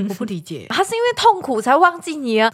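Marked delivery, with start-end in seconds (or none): none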